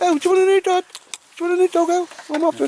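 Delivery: very high, loud squeaky pup voice; dog's voice. Pretend. Owner voicing his dog Baldrick to Al, high squeaky dog voice. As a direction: speak for the dog in a squeaky falsetto. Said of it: "He says".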